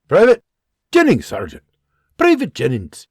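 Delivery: loud military correction. Acted. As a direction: snap to attention and announce. Rider corrects loudly.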